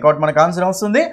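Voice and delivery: normal talking voice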